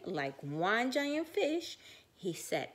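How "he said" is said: usual speaking voice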